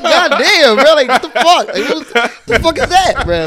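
loud, high-pitched disbelief; mock outraged protest. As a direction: cry out in comic indignation at an insult. Retells outrage at being called ugly.

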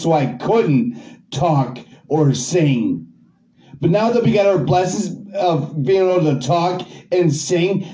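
usual speaking voice

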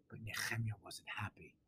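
hushed whisper, as if letting them in on it. Acted. Rabbi whispering an aside to the congregation. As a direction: drop to a breathy stage whisper. Sudden aside mid-sermon.

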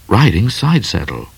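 ordinary delivery, nothing marked

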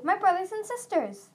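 gleeful at seeing his siblings; delighted. Acted. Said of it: "Clay said delightedly".

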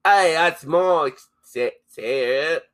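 slow hammed-up Kiwi accent. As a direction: drawl it in a mock Kiwi accent. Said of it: "now you sound Australian".

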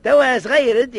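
loud, sharp start of a rebuke; scolding burst. Acted. Old man loudly scolding the boy.

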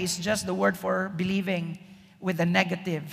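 usual speaking voice

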